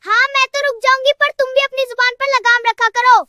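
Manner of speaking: high-pitched, indignant comeback; scolding, pitch pushed up; heated retort. Acted. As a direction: act a cross wife warning her husband. Acted quarrel, wife snaps back.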